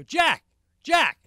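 loud call up the slope, high and urgent. Retold or imitated. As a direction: shout her name up the cliff. Calling up to wife on cliff.

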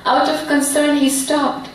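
measured phrases, each image set apart; heartfelt poem recitation. Acted. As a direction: recite your poem solemnly, with feeling. Resumes her poem.

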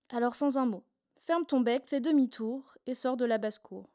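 usual speaking voice

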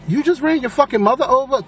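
high-pitched mock disbelief; incredulous. Narrator thinking to himself about the driver, incredulous. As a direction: re-enact an appalled inner thought. Acts out his disbelieving thought.

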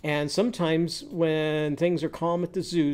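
normal talking voice

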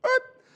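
short, high yelp of a noise; imitated brake stomp. Driver swerving for a beagle, sudden imitated screech. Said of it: "first thing I do is go".

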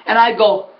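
ordinary talk

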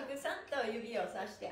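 ordinary talk, nothing marked

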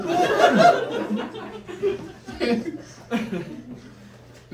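long high laughing fit. Real, not acted. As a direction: keep laughing hard. Laughing at own story.

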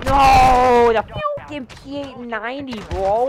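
loud sharp yelled no; frustrated shout. Real, not acted. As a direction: yell it out in frustration.

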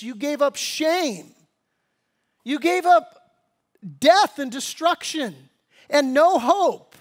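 high, loud, driving sermon climax; fiery preaching. Acted. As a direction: preach it hard at the congregation. Preaching at full force.

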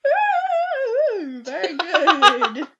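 loud, slow, high ooh of delight; delighted character voice. Acted. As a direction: savour it like a greedy courtier. Character gloats over gold.

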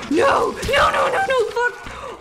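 high, loud frantic screams of no; shrieking no no no; panicked screaming. Real, not acted. Panicked gamer about to die.

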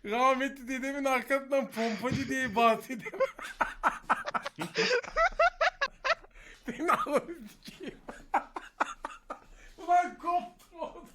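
cracking up mid-sentence; laughing. Real, not acted. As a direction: talk through helpless laughter. Friend calls grandpa pompacı. (gülmekten kırılarak)

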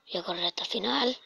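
hushed whisper, no voice. Shown as a demonstration. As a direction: whisper it to yourself. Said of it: "Ahora voy a, voy a correr".

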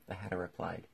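ordinary delivery, nothing marked

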